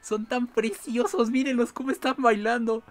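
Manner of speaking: high, slow laughing coo; charmed by dancing villagers; delighted cooing. Real, not acted. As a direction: gush at the dancing animals.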